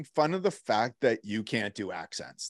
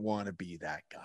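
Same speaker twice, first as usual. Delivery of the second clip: whisper; 4 semitones lower, 11 dB quieter than usual. Real, not acted. Joking about coughing in crowds.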